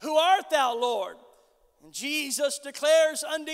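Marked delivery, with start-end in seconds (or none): 0.0-1.2 louder cry that drops away at the end. Acted. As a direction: call up to the Lord, lightly acted. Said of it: "he cries out and says".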